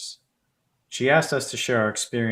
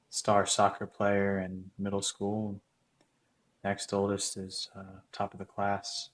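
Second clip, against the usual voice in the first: soft, slow, wistful recollection; subdued regret; 7 dB quieter than usual. Acted. Man admitting his old crush is doing better, subdued and wistful. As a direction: let it sink quietly into resignation. Wistful ending of performed monologue.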